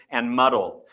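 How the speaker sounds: ordinary talk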